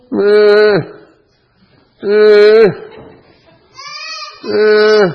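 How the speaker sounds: short, high, loud fake child's wail; mock crying. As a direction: force out fake cries on demand. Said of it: "Okay, you can cry for".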